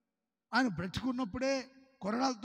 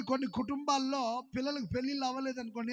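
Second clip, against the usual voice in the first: ordinary talk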